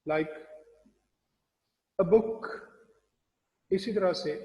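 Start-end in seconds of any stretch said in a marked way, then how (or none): 2.0-2.5 "a book" said as one word, weak uh; weak a joined. Shown as a demonstration. Teacher modelling a joined to the next word. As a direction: run "a book" together with no space. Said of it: "as if they were the part of the next word".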